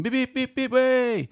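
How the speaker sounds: high squeaky beep beep like a taxi horn; high-pitched honking noises; imitated car horn.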